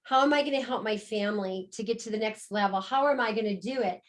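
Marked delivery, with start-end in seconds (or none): none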